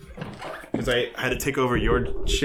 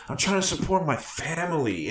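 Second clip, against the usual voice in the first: lightly voiced, higher-pitched self-justification; mock pleading villain; 5 semitones higher than usual. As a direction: whine a little in imitation of his excuse. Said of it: "he's like".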